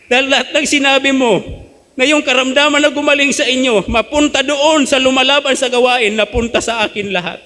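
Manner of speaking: urgent confession. Retold or imitated. Neighbor's confession retold.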